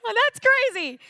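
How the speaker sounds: rising into a squeak of wonder; amazed disbelief. Retold or imitated. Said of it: "And I was like".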